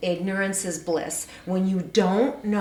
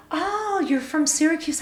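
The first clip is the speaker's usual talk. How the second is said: cheery receptionist; 9 semitones higher than usual. Retold or imitated. Voicing the lady at the desk.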